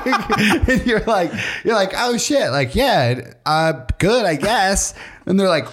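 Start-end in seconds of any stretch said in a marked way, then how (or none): 1.9-4.9 startled unsure reply. Pretend. Caller caught off guard.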